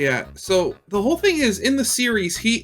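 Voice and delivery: usual speaking voice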